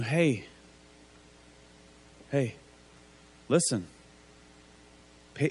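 like a father calming a son; gentle reassurance. Retold or imitated. God reassuring Cain, retold.

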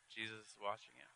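spooky callback. Acted. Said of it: "punchline callback".

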